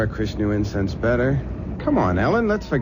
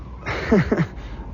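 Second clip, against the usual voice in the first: breathy chuckle; 5 semitones higher than usual. Acted. Amused by the question.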